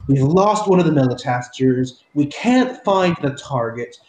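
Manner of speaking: loud, griping soldier voice; aggressive man complaining. Acted. GM voices a soldier complaining to his superior, loud and fed up. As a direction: grumble it out like a fed-up enforcer, slow and loud. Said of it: "the male voice, which sounds to be, I wouldn't say complaining".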